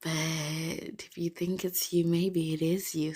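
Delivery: slow teasing whisper; playful secretive whisper. Real, not acted. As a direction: whisper a sly hint to him. Teasing hint to crush.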